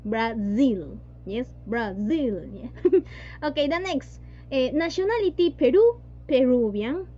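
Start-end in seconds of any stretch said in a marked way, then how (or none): 0.0-2.5 Brasil said as Brazil, slow, the z voiced and buzzing. Shown as a demonstration. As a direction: model Brasil with a vibrating z. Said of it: "la Z que está por ahí se vibra". (Brasil pronunciado despacio con z vibrada)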